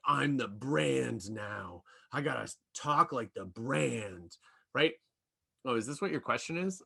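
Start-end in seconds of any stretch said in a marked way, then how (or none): 0.1-4.4 slow, solemn corporate tone; stiff brand voice. Retold or imitated. Imitating a poster at the keyboard, slow and stiff. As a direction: play someone pompously becoming the brand. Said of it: "you're like".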